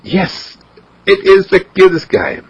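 lightly voiced mother agreeing; mother cooing at her newborn; mother's voice. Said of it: "Mother said".

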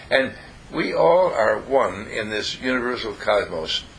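usual speaking voice

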